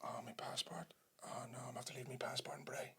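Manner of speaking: voiceless, hushed realisation; horrified whisper. Retold or imitated. Player realising his passport is at home, stunned whisper. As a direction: whisper it to yourself as dread sinks in.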